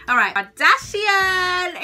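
excited announcement. Real, not acted. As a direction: hype up the next topic like a host. Announcing her next favourite topic.

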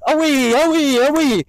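high, loud calls to the dog; bright herding call; called dog command. Shown as a demonstration. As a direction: call it out across the field. Calling dog in demo.

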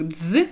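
"дз" as a buzzing d plus z; voiced dz sound. Shown as a demonstration. Teacher showing one reading of zeta, voiced "дз". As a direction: say "дз" fully voiced. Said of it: "читається як звук".

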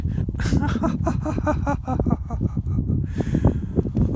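long high-pitched laughing fit; high cackling laughter, running on; real laugh. Real, not acted. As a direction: burst out laughing at your own joke. Laughs at own punchline.

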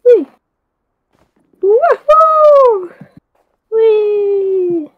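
high, gleeful whee; excited whoop. Real, not acted. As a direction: squeal with delight. Sliding around in the game.

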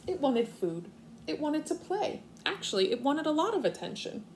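expressive storybook reading for kindergartners; reading to children. Acted. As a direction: read it out like story time on the rug. Teacher reads picture book aloud.

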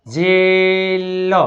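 જેલ with a very long A; slow sounding-out of જેલ; drawn-out pronunciation demo. Shown as a demonstration. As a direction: stretch જેલ slowly, landing on લ. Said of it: "જે એ I L".

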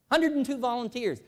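ordinary talk, nothing marked

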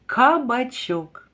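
кабачок slow and falling; slow confirming answer for children; slow confirming word. Shown as a demonstration. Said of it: "Так, дійсно".

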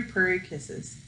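plain reading voice

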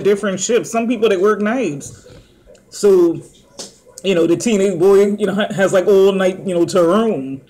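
eating fries between words; talking while chewing. Real, not acted. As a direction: mouth partly full of fries. Seen 1: fry held at lips, mouth working while talking throughout.

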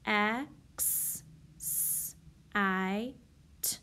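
"X, S, I, T" said as separate letter sounds; teacher sounding out a word, sound by sound. Shown as a demonstration. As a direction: sound out each letter slowly for children. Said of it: "I'm going to sound that word out".

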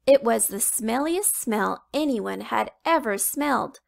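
usual speaking voice

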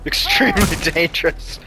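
"Extremely dangerous" broken by a laugh; amused disbelief. Real, not acted. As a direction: laugh in the middle of the phrase. Laughs at game's danger line.

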